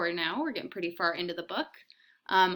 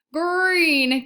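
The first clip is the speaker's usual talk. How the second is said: much higher, louder wail of disgust; wailing child; 10 semitones higher, 12 dB louder than usual. Acted. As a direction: whine it out in despair. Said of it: "Kirsty wailed".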